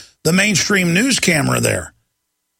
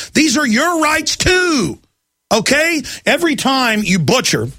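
impassioned rant; 8 semitones higher than usual. Real, not acted. Ranting at the cops.

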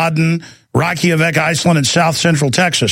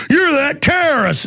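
high-pitched imitation of an outraged stranger; mock accusation; 10 semitones higher than usual. Stranger confronts a TV actor who played a terrorist, mock accusation.

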